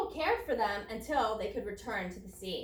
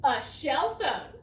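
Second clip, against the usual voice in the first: slow punchline; 5 dB louder, slower than usual. Shown as a demonstration. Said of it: "Here's another joke".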